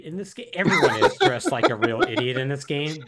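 amused, laugh-filled exclamation. Real, not acted. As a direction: talk through real laughter. Laughing while mocking the costumes.

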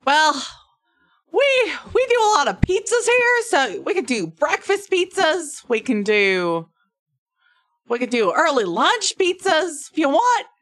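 put-on high-pitched, loud accented tavern keeper; bad-accent barkeep. Barkeep offering breakfast and lunch pizzas, hammed-up accent. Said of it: "only do one version of this accent".

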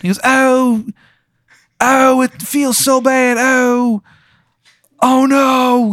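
high, loud hammed-up agony in a Maryland accent; mock dying cries. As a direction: moan like a man being stabbed, overacted. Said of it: "he's like".

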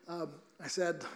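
usual speaking voice

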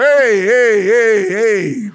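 loud, high cry of hey hey to cut someone off; loud warning hey. Retold or imitated. Butting in on family talk, calling out loud hey. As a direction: shout hey hey like raising a red flag. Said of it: "somebody else jumps in and like".

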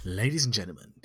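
hushed showman's whisper; whispered announcement. Pretend. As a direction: breathe the welcome like a secret.

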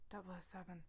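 very soft, weak murmur; barely able to speak. Acted. Injured Q addresses Bond, barely able to speak. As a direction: say it faintly as the injured agent. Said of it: "Barely able to speak".

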